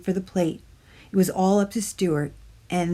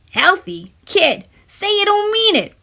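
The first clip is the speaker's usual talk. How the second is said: high, louder wail; wailing tooth; 12 semitones higher, 7 dB louder than usual. A narrator's reading. Tooth wails at Stuart, high and louder. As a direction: plead like it's the end. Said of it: "wailed the Tooth".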